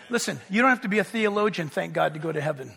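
ordinary talk, nothing marked

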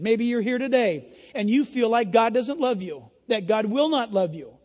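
usual speaking voice

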